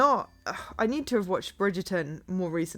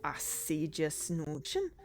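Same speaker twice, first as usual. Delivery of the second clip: Scottish detective; 2 semitones lower, 8 dB quieter than usual. Acted. Detective thanks butler for advice, soft attempted Scottish. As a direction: acknowledge it quietly as a lightly Scottish detective. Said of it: "the detective has to be Scottish".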